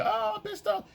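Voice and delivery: very high, light falsetto; mock girlfriend voice. What the girlfriend won't say, in a high mock voice. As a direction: squeak it like the girlfriend. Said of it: "She won't say".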